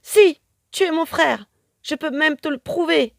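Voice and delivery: high, loud triumphant cry; excited exclamation. A narrator's reading. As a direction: exclaim with conviction. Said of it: "s'écrie Carmelita".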